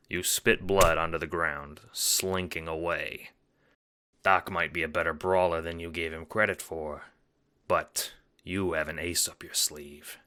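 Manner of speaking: ordinary talk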